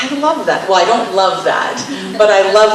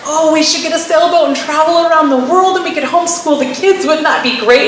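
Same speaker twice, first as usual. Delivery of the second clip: much higher, eager voice of a dreaming husband; dreamy husband; 8 semitones higher, 3 dB louder than usual. Retold or imitated. Husband pitching a sailboat trip to his wife, eager and much higher. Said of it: "our husbands are like".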